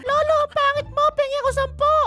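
very high, squeaky teasing voice; high-pitched silly mock voice; squeaky put-on voice. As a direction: pipe up like a tiny cartoon character. Teasing voice for a game character.

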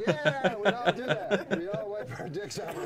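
loud crowd reply. Retold or imitated. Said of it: "everyone in the audience is like".

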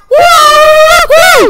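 loud happy whoop; excited whoop. Real, not acted. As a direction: cheer it out. Excited start of gaming video.

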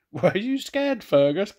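high, loud squealing pig taunt; teasing oinking pig. Acted. Pigs teasing Fergus as they run off, high squealing taunt. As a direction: squeal it like a cheeky piglet. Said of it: "they oinked".

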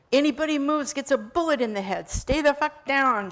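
loud, high armed robber yell. Acted. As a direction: bellow the threat at the whole room. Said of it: "she yelled at the room".

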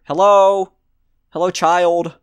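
loud high call into the empty room; calling out loudly. Real, not acted. Player calling to the hidden child, loud and high.

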